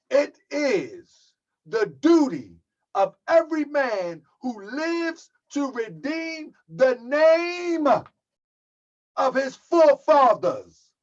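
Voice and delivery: very slow, word by word. Shown as a demonstration. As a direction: drag each word out deliberately for the congregation. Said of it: "I'ma say it slow".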